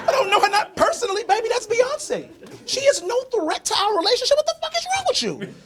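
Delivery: very high-pitched, incredulous argument; acted-out squealed exasperation; exasperated protest. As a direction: protest in a high strained voice, baffled by the accusation. Acted quarrel, protesting to jealous girlfriend.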